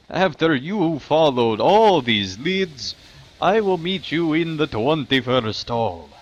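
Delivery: NPC quest voice. Acted. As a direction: read it like a village elder. NPC quest text continues.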